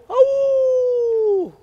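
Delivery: very high, loud yelp of pain; the scalded coyote cries out, very high and loud; scalded howl. Acted. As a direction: howl as the water burns. Coyote scalded by boiling water.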